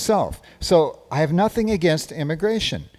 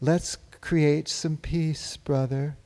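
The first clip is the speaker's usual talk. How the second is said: slow, soft and mellow, like a peace-loving hippie; mock hippie calm, slowed right down; 5 dB quieter, much slower than usual. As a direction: drift it out lazily, man. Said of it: "calmly say".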